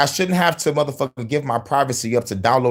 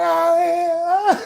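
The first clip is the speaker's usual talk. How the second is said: squeaky high imitation, much higher; high mock voice. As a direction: pitch it way up in a silly impression. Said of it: "He's like".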